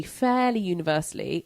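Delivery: ordinary talk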